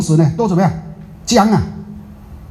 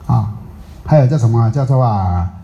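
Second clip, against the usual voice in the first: ordinary talk, nothing marked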